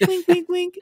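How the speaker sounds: squeaky falsetto fake sobs; hammed-up crybaby noises; mock crying. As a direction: whimper in a tiny, very high voice. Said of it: "I cry my little winky eyes out".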